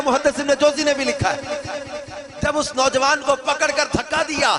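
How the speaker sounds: loud, high impassioned sermon; fiery preaching. Acted. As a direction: preach it at full force. Preaching at full force. (پرجوش خطابت)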